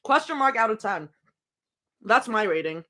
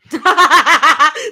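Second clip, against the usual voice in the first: a sudden high burst of real laughter; real loud laugh; 7 semitones higher, 11 dB louder than usual. Real, not acted. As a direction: crack up out loud. Laughs at funny post.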